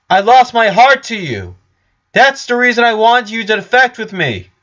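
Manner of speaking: high and loud, pleading; loud calling. Acted. Flower calls her love to the hidden Richard, loud and high. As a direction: call out a confession into the dark.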